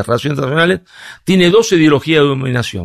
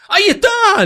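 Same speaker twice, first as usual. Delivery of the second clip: much higher, lightly laughing ahí está; high mock agreement. Speaker to the university student, answering in a high mock-agreeing voice. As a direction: chirp it out high, teasing the student. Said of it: "le digo".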